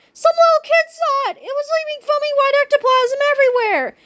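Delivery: very high stuttering shriek; Wendy crying to Kipper, high stuttering fright; stammering terror. A narrator's reading. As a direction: stutter it in panic. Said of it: "cried Wendy Swan".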